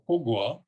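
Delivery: "ko gwa", high tone then mid tone; high plus mid tone. Shown as a demonstration. Lecturer giving another form, "ko gwa" high then mid. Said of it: "high plus midtone pattern".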